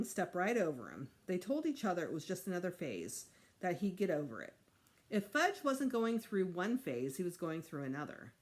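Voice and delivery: ordinary delivery, nothing marked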